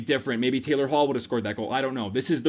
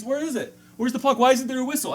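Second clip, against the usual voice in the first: much higher, frantic mock voice; confused goalie; 11 semitones higher than usual. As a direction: whine like a goalie who lost the puck. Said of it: "He literally does one of these".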